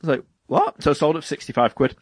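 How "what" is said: squeaky disbelieving yelp, pitched way up; high startled what; shocked what. Retold or imitated. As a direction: jump up high in mock disbelief. Incredulous reaction to the game's value.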